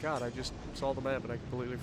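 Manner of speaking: ordinary talk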